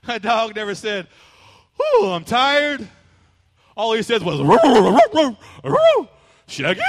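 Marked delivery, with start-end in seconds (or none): 1.8-2.9 tired dog. Pretend. Imagined dog talking. 4.4-6.8 loud, high cartoon dog roo-roo; dog barking words. Pastor mimics a talking cartoon dog, loud high barks. Imitating Scooby-style dog.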